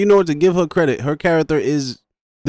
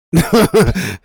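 loud burst of laughter; 8 semitones higher, 9 dB louder than usual. Real, not acted. Laughing at Jason joke.

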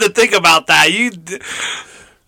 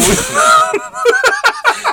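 shrieking laugh. Real, not acted. Cackling at own crude joke.

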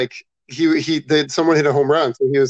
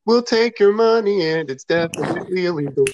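ad read; 4 semitones higher than usual. Pretend. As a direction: sell it like a bad commercial. Ad tagline.

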